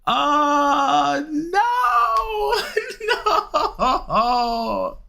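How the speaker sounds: real anguished no; despairing wail. Real, not acted. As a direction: wail at the bad luck, half laughing. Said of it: "I'm about to cry".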